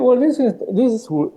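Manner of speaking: normal talking voice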